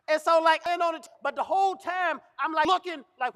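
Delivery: normal talking voice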